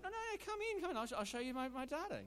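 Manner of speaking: very high, loud coaxing rabbit; a rabbit squeaking high to coax the wolf inside; insistent rabbit. Acted. Joke, rabbit insists wolf come in.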